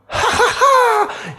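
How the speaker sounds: put-on very high, slow cackling child laugh; gleeful child laugh. A narrator's reading. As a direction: laugh like a delighted little boy. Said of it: "he cried".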